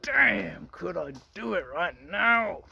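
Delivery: high, slow, drawn-out swooning voice; mock tempted man. Mocking a viewer caught up in lust. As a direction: ham up giving in to temptation. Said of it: "you're gonna be like".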